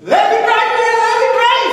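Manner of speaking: very high, loud acted scream; screaming demand. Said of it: "he's screaming at me".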